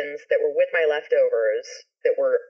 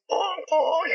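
high nasal horn honk; 12 semitones higher than usual. Retold or imitated. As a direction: honk like an old klaxon horn.

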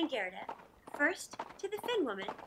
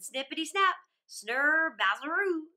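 louder, bold playful nonsense words; a cheeky robber girl's louder exclamation; brash robber girl; 7 dB louder than usual. Acted. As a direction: toss off the nonsense with gusto.

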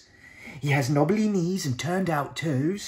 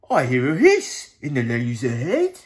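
louder, booming monster voice; Gruffalo voice; 9 dB louder than usual. Acted. Gruffalo eyes the mouse as food, booming. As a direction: rumble it like a big hungry monster.

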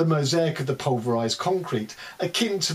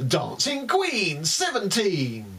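higher drawn-out bingo call; bingo caller; 7 semitones higher, slower than usual. Pretend. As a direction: announce the number to the hall. Bingo calls in poem.

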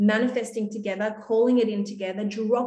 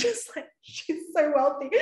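laughing; 6 semitones higher than usual. Real, not acted. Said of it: "that's how I felt".

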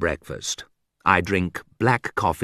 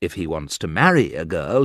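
plain reading voice

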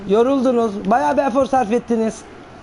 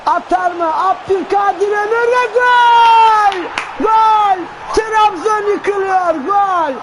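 full-throated goal scream, high and loud; screaming football commentator; 10 semitones higher, 4 dB louder, slower than usual. Real, not acted. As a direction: roar the goal call, stretching it out. Goal call in live commentary. (çılgınca bağıran spiker)

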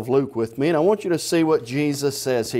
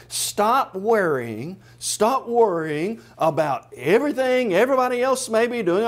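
ordinary delivery

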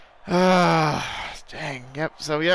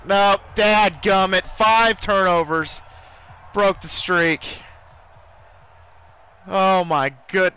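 dejected groan; 2 semitones higher, 4 dB louder, slower than usual. Real, not acted. Team just lost the game.

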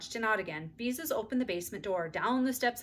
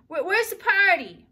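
put-on puzzled child; 11 semitones higher, 12 dB louder, slower than usual. A narrator's reading. Little boy asking loudly.

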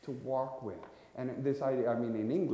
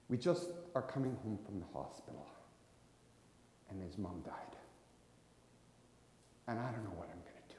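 grieving hush; 4 semitones lower, 8 dB quieter, faster than usual. Acted. Grieving father explains to passenger.

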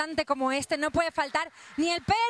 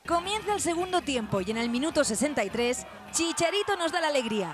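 news voiceover; 4 semitones lower than usual. A narrator's reading. Reporter narrating the match for viewers, news read.

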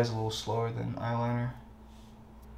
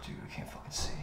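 whispering; 12 dB quieter, faster than usual. Real, not acted. Struggling, mirror too far.

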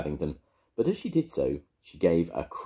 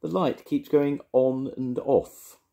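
higher, louder and slow; exclaiming; 10 semitones higher, 5 dB louder, slower than usual. A narrator's reading. Paddington tells Mister Brown the light is broken, slow and higher. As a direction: insist on it, wide-eyed. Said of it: "he exclaimed".

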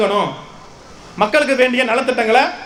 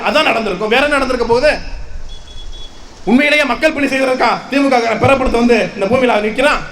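ordinary talk

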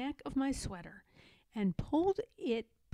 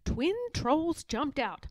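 ordinary delivery

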